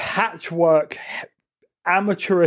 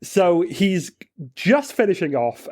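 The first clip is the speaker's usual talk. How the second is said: ordinary talk, nothing marked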